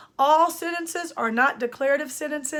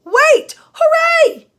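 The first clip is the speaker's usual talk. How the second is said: loud, high-pitched exclamations; demonstrated shouts, pitched way up; exclaimed interjections; 13 semitones higher, 10 dB louder, much slower than usual. Shown as a demonstration. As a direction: call out each word with a burst of excitement. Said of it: "we have something called interjections".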